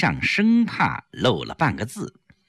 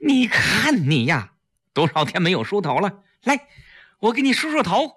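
higher-pitched, mildly raised fussing; speaking up; 7 semitones higher than usual. A narrator's reading. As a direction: fuss over a friend's hair for eavesdroppers. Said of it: "大声说". (提高嗓音数落)

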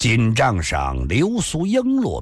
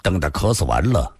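ordinary delivery

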